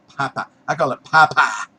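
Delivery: Texan "Popeye", vowels flattened to "Pah-pah"; Texas accent. Shown as a demonstration. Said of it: "excuse my Texas accent".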